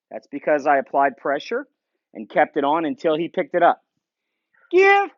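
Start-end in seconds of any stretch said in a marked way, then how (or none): 4.7-5.1 release command. Real, not acted. Commanding dog to release bumper.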